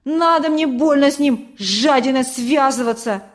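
loud, scornful retort; dismissive boy's snap, strongest at the start; snapping back. A narrator's reading. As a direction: snap defensively as an accused boy. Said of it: "огрызнулся Петька".

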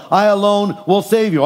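ordinary talk, nothing marked

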